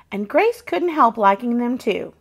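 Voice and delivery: ordinary delivery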